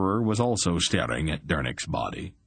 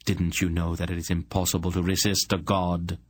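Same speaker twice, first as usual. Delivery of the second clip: usual speaking voice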